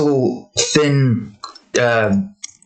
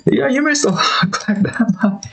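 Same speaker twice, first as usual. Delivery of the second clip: higher, amused teasing; high giggly teasing voice; teasing Honeybee; 9 semitones higher than usual. Retold or imitated. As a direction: tease him with a laugh.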